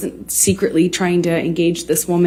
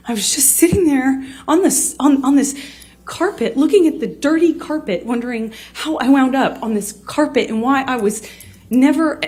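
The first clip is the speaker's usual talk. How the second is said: higher, strained voice close to tears; fighting back tears while telling it; tearful, voice breaking; 6 semitones higher than usual. Real, not acted. Telling how her partner hit her, through real tears. Recounting being slapped, emotional.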